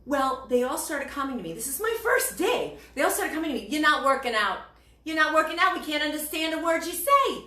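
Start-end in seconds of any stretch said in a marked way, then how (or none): 3.7-7.4 put-on loud, high Brooklyn accent complaint. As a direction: imitate exasperated co-workers in a thick Brooklyn accent. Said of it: "they talked a certain way".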